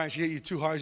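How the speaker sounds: normal talking voice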